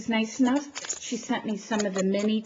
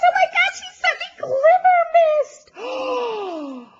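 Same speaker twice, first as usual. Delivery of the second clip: very high-pitched excited squeal; overjoyed, voice shooting way up; thrilled squeal; 5 dB louder, faster than usual. Real, not acted. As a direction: shriek with delight at the gift. Discovering a coveted gift.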